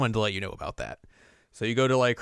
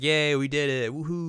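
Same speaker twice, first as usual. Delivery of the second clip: light, higher-pitched team celebration; mock cheering; 5 semitones higher than usual. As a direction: give a playful little hooray. Acting the happy team cheering.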